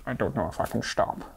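low, soft Scottish burr; put-on Scottish accent. Player admitting the voice has stuck, low Scottish accent. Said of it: "It's a very good accent".

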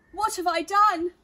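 loud, high panicked cry. Acted. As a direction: wail it in alarm. Panicked man fleeing the cake.

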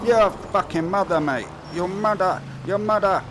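mock Italian cursing, loud and high; hammy Italian swearing at the game; loud Italian-style cursing; Italian cussing. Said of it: "That's my Italian cussing".

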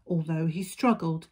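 ordinary delivery

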